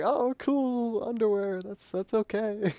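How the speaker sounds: high, slow forced politeness; fake enthusiasm. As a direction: pretend to like a bad gift. Kid disappointed by gift.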